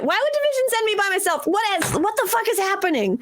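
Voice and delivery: high, fast, bewildered outburst; panicked disbelief. Acted. Recruit realizing Division sent them alone, high-pitched panic. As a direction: spiral into alarmed questions, pitched right up. Character realizes they were set up.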